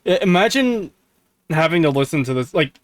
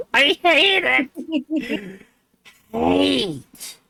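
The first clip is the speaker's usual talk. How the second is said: high, slow, groaning through a laugh; laughing disgust; 11 semitones higher, much slower than usual. Real, not acted. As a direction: drag out hate, half laughing. Can't unhear the cadence.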